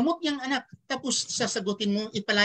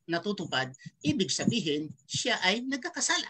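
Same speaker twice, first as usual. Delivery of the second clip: usual speaking voice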